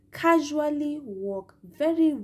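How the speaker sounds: casually walk very, said slowly and drawn out. Shown as a demonstration. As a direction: stretch out casually walk very, dragging each word. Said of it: "I want to emphasize on the word casually".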